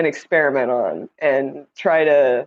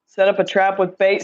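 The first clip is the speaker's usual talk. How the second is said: ordinary delivery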